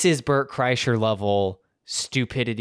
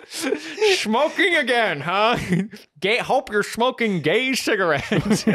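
high silly voice; 10 semitones higher than usual. Acted. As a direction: squeak it like a cartoon. Joking silly character voice.

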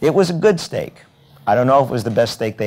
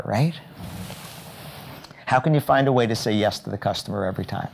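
ordinary talk

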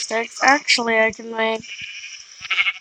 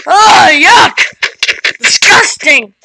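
loud, high yelp of disgust; disgusted shout; 5 semitones higher, 9 dB louder, much slower than usual. Pretend. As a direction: shout it like something stinks. Exterminator sees the dead donkey.

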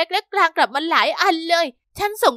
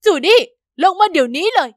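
shouting; 8 dB louder than usual. A narrator's reading. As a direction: yell at her daughter to get down. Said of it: "ตะโกน".

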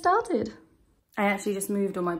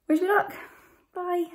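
bright sign-off; 11 semitones higher than usual. Real, not acted. Cheery sign-off before her exam.

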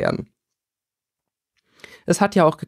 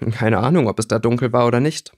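ordinary delivery

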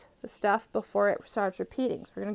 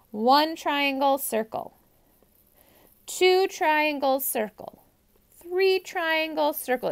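stretched numbers, higher each time; slow, lean on each number; emphasized counting; 5 semitones higher, slower than usual. Shown as a demonstration. Counting growing pattern emphatically.

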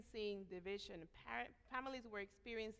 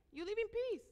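high, louder scoffing imitation of the empire; mocking Roman ruler; 10 semitones higher, 5 dB louder than usual. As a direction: toss it off high and smug. Preacher mocks Roman Empire's claim.

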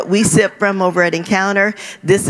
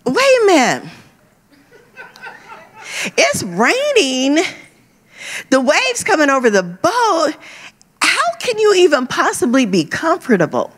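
incredulous self-quote; 8 semitones higher than usual. Retold or imitated. Preacher retelling her own disbelief at sleeping Jesus, high and incredulous. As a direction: ask it like it makes no sense at all. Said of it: "I'm like".

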